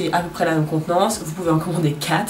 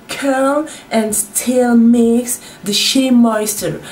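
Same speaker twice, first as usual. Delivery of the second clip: product name said with a hammed-up English accent; exaggerated English accent on the brand name; mock English accent; 5 semitones higher, 4 dB louder, much slower than usual. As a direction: roll the English words out slowly in an English accent. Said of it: "j'ai un pur accent anglais".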